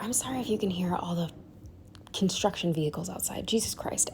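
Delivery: hushed whisper, quick at first. Real, not acted. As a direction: breathe it out in a whisper. Whispers an apology over construction noise.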